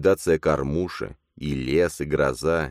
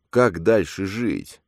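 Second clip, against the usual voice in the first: choking on saliva; 5 semitones higher, 3 dB louder, much slower than usual. A narrator's reading. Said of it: "гнусаво хрипел Богдан, давясь слюной".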